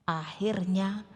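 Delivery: ordinary talk, nothing marked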